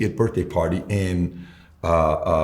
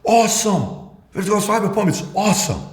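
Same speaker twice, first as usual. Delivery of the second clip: much higher, pumped-up cheer; excited cheer; 10 semitones higher than usual. Retold or imitated. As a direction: hype the team up. Voicing his own cheer to team.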